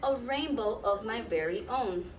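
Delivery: plain reading voice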